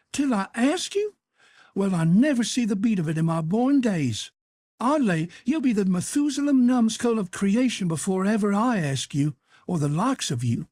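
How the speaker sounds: an aunt demanding names; scandalized auntie; incredulous outrage. A narrator's reading. Aunt Sally scornful.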